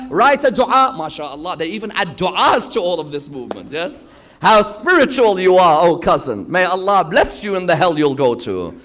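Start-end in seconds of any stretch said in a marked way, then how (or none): 4.4-8.8 sarcastic. Acted. Mocking the scheming cousin.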